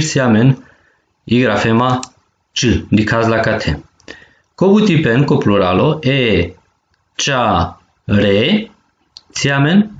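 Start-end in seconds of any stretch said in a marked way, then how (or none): 6.0-8.7 cea and re as separate held beats; syllable by syllable. Shown as a demonstration. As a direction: break it into cea - re with pauses. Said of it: "dui silabe". (pe silabe: cea, re)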